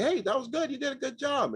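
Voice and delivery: very high-pitched impersonation voice; high, squeaky-voiced celebrity impression; Jay Leno. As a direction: pitch it way up, mimicking a talk show host. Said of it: "And it's Jay Leno".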